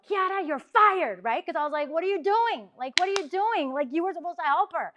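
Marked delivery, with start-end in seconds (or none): 0.1-1.2 high, loud, slow outburst; blurted out. Retold or imitated. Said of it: "just blurted out of my mouth". 1.9-4.9 higher, exasperated mock scolding. Scolding Kiara in retold scene.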